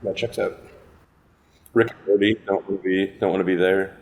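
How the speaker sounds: ordinary talk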